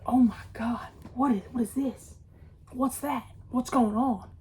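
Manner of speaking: hushed, frightened whisper of a retold moment; scared whisper. Hearing a growl in the dark, a frightened whisper. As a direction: breathe it out scared, barely voiced.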